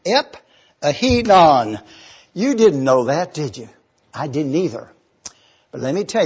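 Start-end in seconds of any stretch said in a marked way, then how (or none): 0.0-1.8 Ep-Ahinon, slowly, syllable by syllable; slow spelled-out pronunciation. Shown as a demonstration. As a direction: stretch out Ep-Ahinon as EP-ah-HEE-non. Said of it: "this word is pronounced".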